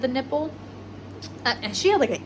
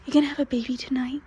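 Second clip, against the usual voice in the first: whisper; 3 semitones higher, faster than usual. Real, not acted. Asking the pregnant mare softly.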